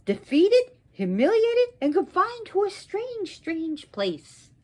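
high, swooping pronouncements; wrathful curse. Acted. Goddess turns on Indra and dooms him, wrathful curse. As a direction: build the list to a loud, high peak.

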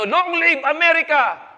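high, slow patriotic cry; shout. Retold or imitated. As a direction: cry it out like a proud American. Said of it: "Biglang sumigaw ang Amerikano".

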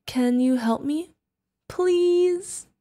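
usual speaking voice

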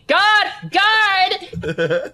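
high, loud yell for help; acted high-pitched shout down the hall; calling for the guard. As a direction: cry out to summon a guard. Prisoner calls out for the guard.

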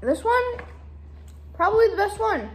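chewy candy in mouth; talking while chewing candy. Real, not acted. Seen 1: Speaker was chewing beforehand; lips pressed and working between words.

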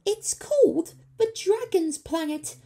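very high, loud scared creature; nervous squeak. A narrator's reading. Creature scared of the planet, nervous squeak. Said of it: "squeaked a nervous Sproggle".

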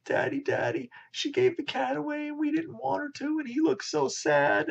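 put-on high little kid voice, whining to daddy; child voice. Children complaining to their father about the cat. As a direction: play a small upset child tattling.

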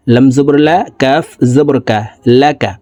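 usual speaking voice

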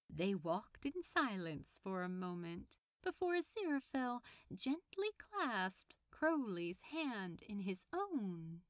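usual speaking voice

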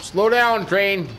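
high-pitched call to brake; alarmed command. Pretend. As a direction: plead with a runaway machine. Cannot stop the train.

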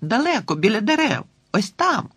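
high, thrilled directions; excited pointing. Acted. Girl pointing out the distant dog to her friend, high and excited.

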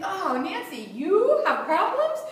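mock surprised. Coach quoting people who think she never struggles, mock surprised. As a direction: play people's disbelief, amused. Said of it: "a lot of people think".

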